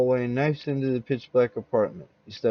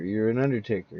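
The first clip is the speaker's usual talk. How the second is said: plain reading voice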